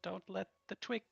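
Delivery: sweet goodnight from a waitress. Acted.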